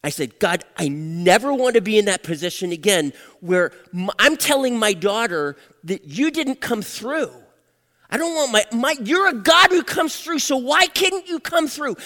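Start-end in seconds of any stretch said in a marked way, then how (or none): 0.4-7.3 loud, raised-pitch protest; angry, upset protest. Retold or imitated. Father telling God he was let down, angry and loud. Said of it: "I was angry. I was upset". 8.1-12.0 high, loud impassioned demand; angry, hurt protest. Retold or imitated. Father confronting God for not coming through, angry and high. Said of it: "I was angry. I was upset".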